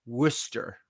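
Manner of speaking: Worcester in two syllables, "WUSS-ter"; local pronunciation of Worcester. Shown as a demonstration. Showing how he would say it, Worcester as WUSS-ter. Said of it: "I would say that like".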